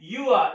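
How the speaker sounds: "you are" run together with silent r; contracted pronunciation. Shown as a demonstration. Teacher models the short form of you are, r silent in "you are". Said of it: "इसको पढ़ते हैं".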